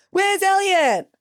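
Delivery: high mimed shout that falls away; retold call back. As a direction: echo the question as a high, falling call. Rescuers' retold shouted question back.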